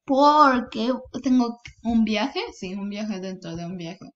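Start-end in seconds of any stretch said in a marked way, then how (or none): 0.1-2.4 slow, loud and proud; dramatic announcement. Pretend. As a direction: announce it like big news. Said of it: "y yo diré".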